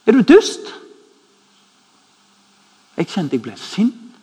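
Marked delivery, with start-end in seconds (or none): 0.1-0.7 indignant outburst. Acted. Outburst at friend.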